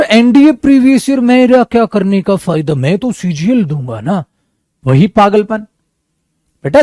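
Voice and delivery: ordinary talk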